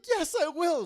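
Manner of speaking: high, loud fake-sweet yes; mock sweet bride. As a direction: squeal it like a gushing bride. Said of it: "She's like".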